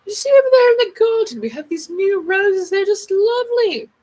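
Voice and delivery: high, loud posh British lady; posh old British accent. Pretend. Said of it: "We used to fucking talk like that".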